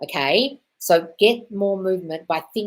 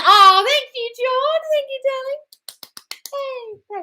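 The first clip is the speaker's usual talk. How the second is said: very high, sing-song put-on voice; gushing diva thanks; 9 dB louder, slower than usual. Thanking Jordan in the live chat, a high gushing diva coo.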